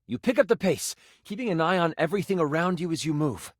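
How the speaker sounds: ordinary talk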